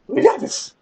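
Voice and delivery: high, squeaky pep-up cry; hyped up. Real, not acted. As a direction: pump yourself up. Psyching himself up.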